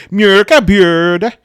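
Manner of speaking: exaggerated Swedish pronunciation, slow, high and loud; "Mjölka björd" drawn out slowly in a hammed-up Swedish accent; mock Swedish. Shown as a demonstration. YouTuber mocking the Swedish pronunciation, hammed-up Swedish accent. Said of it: "the way to actually say this in Swedish".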